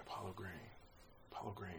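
softly testing how a new name sounds; saying it to himself. Retold or imitated. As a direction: try the name softly under your breath. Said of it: "I'm saying it to myself".

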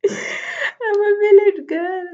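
high, loud, drawn-out squeal; playful exclamation. Pretend. Podcaster jokes about her village roots, playful high squeal. Joking proud exclamation after laughing.

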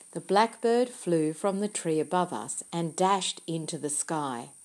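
ordinary delivery

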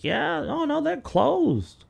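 high, slow, drawn-out janitor voice; lazy janitor. Retold or imitated. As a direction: play a clueless janitor, dragging it. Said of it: "some janitor's like".

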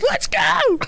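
very high laughing hype yell. Retold or imitated. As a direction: yell it like a hyped teen. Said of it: "the guy that gets up and goes".

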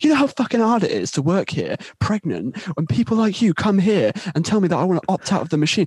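high-pitched indignant rant; pissed-off staffer. Retold or imitated. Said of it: "really pissed off at me".

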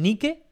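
Nike said "NI-ke", as written in Spanish; Nike with pure Spanish vowels; Spanish spelling reading. Shown as a demonstration. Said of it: "pero no dicen Nike".

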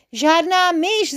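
high, loud, drawn-out call. A narrator's reading. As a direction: call it out slowly to everyone. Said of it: "zavolal Jeroným".

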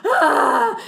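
loud mock scream through a laugh. [name] sees the broken arm, loud laughing scream.

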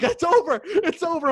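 high, loud panicked cry. Retold or imitated. Fantasy player sure he lost the game, panicked high cry. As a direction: wail that it's lost. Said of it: "I'm like".

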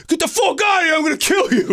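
high shouted mock threat; heard yelling. Husband pounds on Devin's door, high mock shout. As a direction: roar it from upstairs. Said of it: "And I hear".

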